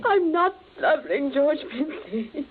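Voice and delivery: loud, tearful retort, slowing on the name; tearful protest. Acted. The sister to her brother, a tearful protest. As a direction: fight back through tears. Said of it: "without blubbering".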